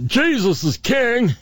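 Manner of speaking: high, slow mocking chant. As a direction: sneer it in a dopey high voice. Repeating the mocked slogan.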